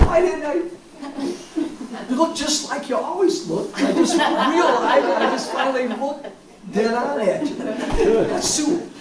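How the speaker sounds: words spilling out through high laughter; laughing at finally recognizing Sue; laughing while talking. Real, not acted. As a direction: talk through real, delighted laughter.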